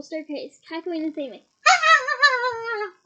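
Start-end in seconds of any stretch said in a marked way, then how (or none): 1.7-2.9 loud high yelp; a short shout; startled cry. Acted. Cry out as Peeves flees.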